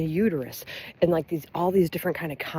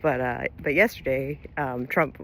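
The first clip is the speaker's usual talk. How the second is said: ordinary talk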